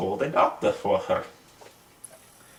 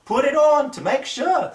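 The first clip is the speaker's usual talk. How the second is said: put-on much higher, louder boyish urging; eager schoolboy; 13 semitones higher, 4 dB louder than usual. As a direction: egg on your classmate eagerly. Classmate urges him.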